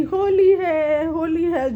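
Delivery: mild mock shout, high and long; retold festive cry. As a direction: imitate calling out a festival greeting, stretching the words. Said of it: "ज़ोर ज़ोर से बोलने लगी".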